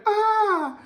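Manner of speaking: high mock shriek of the neighbour woman; imitated scream. Imitating the screaming neighbour in a high mock shriek. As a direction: squeal it way up high like the woman next door. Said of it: "she's screaming".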